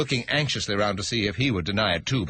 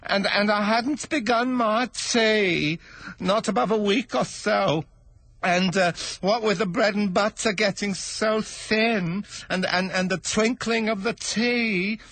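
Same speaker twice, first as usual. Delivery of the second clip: trembling voice; 12 semitones higher, slower than usual. Acted. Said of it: "the hatter began in a trembling voice".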